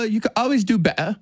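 high British voice, T's dropped in "better"; mock-British with "better" as be'uh; British accent. Shown as a demonstration. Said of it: "any word that has, like, two T's together".

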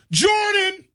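high, excited shout of the name as the paper flies; calling out a name. Retold or imitated. Host showing listeners the trash-can jump shot, high excited call. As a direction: call it like a buzzer-beater. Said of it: "If you don't say either".

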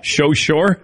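"So sure" echoed as "sho sure"; mimicked slushy S. Host echoing colleague's slip, mock slushy S on "So sure". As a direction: teasingly copy the slip, "sh" for the S in "So". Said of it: "I did a little slushy yes there".

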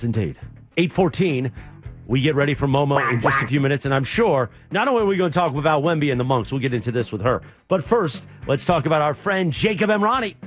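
usual speaking voice

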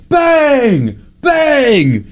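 high mocking shout of Bang; mock announcer yelling Bang at a brick; imitating the Instagram guy. Son imitates a heckler mocking missed shots, high shout. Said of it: "he'll go".

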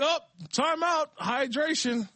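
high-pitched mocking announcement; mock time-out call. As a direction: call the break in a silly high voice. Mocks the break being called.

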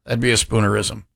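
ordinary talk

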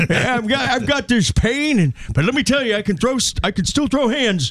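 put-on high, gruff old grandpa; grandfather voice. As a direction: play the feisty old grandpa. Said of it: "That was my grandfather".

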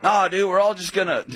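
high, loud-ish dude impression; mock college-dude enthusiasm; college roommate. Imitating college roommates making plans.

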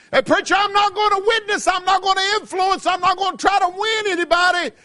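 hammed-up stubborn churchgoer; mock listener. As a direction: pitch it up and shout the refusal. Mocking reluctant witness.

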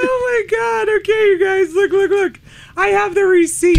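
high, urgent exclaiming; excited. Real, not acted. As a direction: get everyone to look, pitch way up. Excitedly showing proof of the code.

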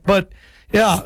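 normal talking voice

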